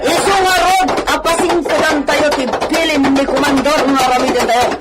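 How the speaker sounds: loud, high calls to the men; shouting out. Acted. Bandit leader calls to his men, shouting. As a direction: bellow orders across the ranch.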